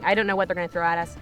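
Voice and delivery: ordinary talk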